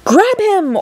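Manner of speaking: urgent order. A narrator's reading. Wonka ordering the mother to grab the boy, urgent command. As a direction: bark the order quickly. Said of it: "ordered Mister Wonka".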